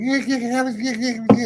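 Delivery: high, eager repeated yeahs; a passenger who has just spotted something outside; excited yelling. Retold or imitated. Passenger urgently pointing out the window to the driver, high and excited. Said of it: "starts going".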